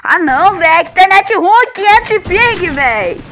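loud annoyed gamer rant; frustrated outburst. Real, not acted. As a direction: blow up at the bad connection. Raging at laggy internet.

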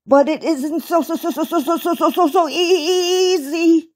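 stuttering on every so; stammer, shaky and nervous. A narrator's reading. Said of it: "stammered Uncle Wiggily".

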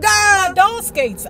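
loud high slow shriek of joy. Retold or imitated. Girl shrieking over her new skates, joyful squeal. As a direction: squeal "My doll skates" with delight. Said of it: "I shrieked".

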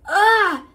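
high, loud scream of terror; a loud cry of horror; terrified scream. Acted. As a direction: let out a sudden scream of fright. Malfoy screams at the hooded figure.

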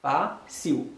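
"Fa, si ou" as "fa... siu"; two separate syllables. Shown as a demonstration. Teacher showing the two sounds heard, separate syllables. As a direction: pause between the syllables of "Fa, si ou". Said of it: "se de son ke ou tande".

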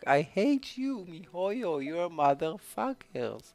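very high, exasperated wailing tirade; outraged rant. Real, not acted. Gamer to miHoYo after defense ruined it, outraged rant. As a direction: start with a yell, keep complaining up high. Outburst at getting defense again.

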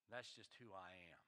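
low, soft, beaten-down murmur; resigned and defeated. Acted. Pastor voicing a person crushed by criticism, softly defeated. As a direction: let the voice sink and give up.

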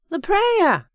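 very high, loud character reminder; exasperated Agatha. A narrator's reading. Agatha reminds him to pray.

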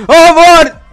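high, loud cry of the name; excited shout. Real, not acted. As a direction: yell it out in hope. Calls out the card.

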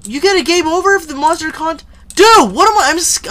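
high-pitched, loud panic; alarmed, voice shooting up; scared. Real, not acted. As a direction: blurt it out, rattled. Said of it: "I'm scared".

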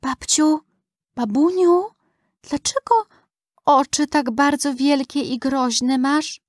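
put-on high-pitched, hesitant little girl with pauses. A narrator's reading. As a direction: ask haltingly as a scared girl at the bedside. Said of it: "i babci szepcze koło uszka". (wysoki, niepewny głos dziewczynki)

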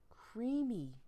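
dreamy emphasis on creamy; drawn-out creamy. Acted. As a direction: savour the word creamy. Stressing the word creamy.

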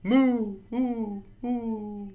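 high moo echoing and fading; tunnel echo. Acted. Reader acts a cow in a tunnel, fading echo. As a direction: repeat the moo, each echo fainter. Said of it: "even stranger in a tunnel".

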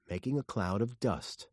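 ordinary delivery, nothing marked

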